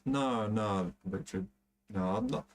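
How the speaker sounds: normal talking voice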